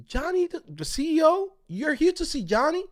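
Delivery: high, disbelieving questions; can't believe who he wants to see; incredulous receptionist. Retold or imitated. Receptionist doubting the visitor, high disbelief. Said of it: "She was like".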